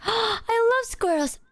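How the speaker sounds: squealing at squirrels by the golf cart; gushing delight. Retold or imitated. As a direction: coo at the squirrels in awe. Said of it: "And I'm like gasps".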